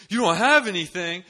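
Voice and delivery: very high, very loud incredulous question; shocked disbelief. Retold or imitated. Friend shocked at the missing gift, incredulous. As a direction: throw it back, aghast.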